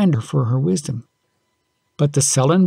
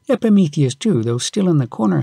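usual speaking voice

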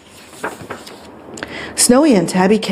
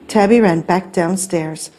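usual speaking voice

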